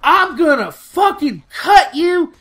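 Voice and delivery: high-pitched mock mom screaming threats; screaming angry mom. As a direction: scream it like an enraged mother catching him out. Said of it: "her mom gets out the car and she's like".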